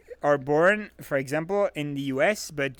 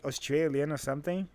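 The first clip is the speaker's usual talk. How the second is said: usual speaking voice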